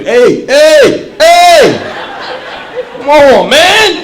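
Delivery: put-on loud, high frantic yelling at a toddler; yelling at the baby. Panicked dad shouting commands at his baby. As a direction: bark orders at a baby who won't listen.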